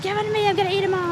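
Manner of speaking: high, fast, grabby mock demand; snatch it with playful greed; fast, high hammed-up greedy grab; greedy excitement. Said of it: "Alan's like".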